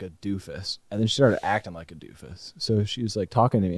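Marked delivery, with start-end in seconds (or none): none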